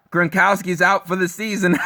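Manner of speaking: laughing through the words; laughing mockery. Real, not acted. Mocking Brady fans with a laugh, laughing. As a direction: gloat while laughing it out.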